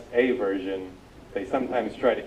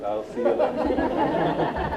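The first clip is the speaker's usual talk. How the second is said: laughing; 13 semitones higher, 4 dB louder, much slower than usual. Real, not acted. Laughing while quoting.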